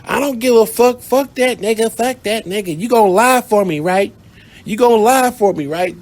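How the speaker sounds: high, loud furious insistence; angry demand. Retold or imitated. Girlfriend angrily demanding the narrator lie for her. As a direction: press him hard to lie for her. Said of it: "She was like".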